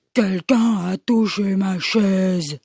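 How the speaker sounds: low, slow Papa Bear growl; big growling voice. Acted. Papa Bear seeing his chair moved, low slow growl. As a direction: rumble it slowly as a big bear. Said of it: "il gronde de sa grosse voix".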